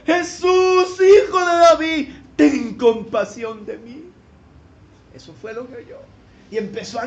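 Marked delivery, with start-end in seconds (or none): 0.0-4.0 a loud, higher cry for mercy. Retold or imitated. Blind beggar crying out to passing Jesus, loud and high. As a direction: call out to Jesus across the crowd. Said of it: "dio voces diciendo".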